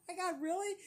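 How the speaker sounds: high, amazed retold reaction; going nuts. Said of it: "they were going nuts".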